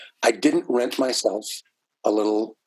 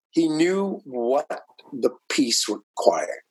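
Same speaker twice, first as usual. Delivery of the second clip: normal talking voice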